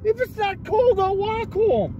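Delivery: high, exasperated outburst; angry retort. Real, not acted. Driver to passenger who wants the window open, angry retort. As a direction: fire back, pitch jumping up in anger. Quarrel over the ride home.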